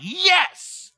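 loud, high eager yes yes; excited yes. Retold or imitated. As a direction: grab at the promise with delight. Excited reaction to verse.

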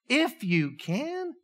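Jesus throwing the doubt back at the father; incredulous echo. Acted.

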